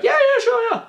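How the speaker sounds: high, sing-song teasing agreement; a high, mock-cheerful yeah; fake-nice yes. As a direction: lure the kid in sweetly. Teasing future kid.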